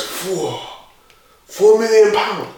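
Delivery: hushed first word, then high-pitched repeat; retold disbelief. Colleague reacting to a big deal, retold incredulity. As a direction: imitate someone taken aback, voice leaping up. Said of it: "and the guy goes".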